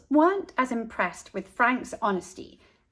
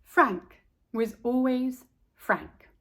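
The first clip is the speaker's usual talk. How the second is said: put-on slow, gentle read-aloud to children; reading a picture book to little ones; storybook narration; 2 semitones higher, slower than usual. A narrator's reading. Storyteller reading to kids, slow and gentle.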